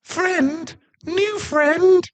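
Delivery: very high, eager puppy voice; excited dog boy. Acted. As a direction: yip it out like an overjoyed puppy.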